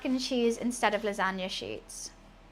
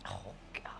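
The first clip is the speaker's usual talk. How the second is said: whispered; 17 dB quieter than usual. Real, not acted. Dismay at stained top.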